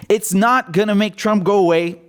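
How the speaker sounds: ordinary talk, nothing marked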